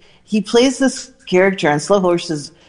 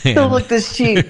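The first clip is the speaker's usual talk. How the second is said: higher, playful imitated voice; 7 semitones higher than usual. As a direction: toss the line back up high, mimicking her. Echoing [name]'s famous line.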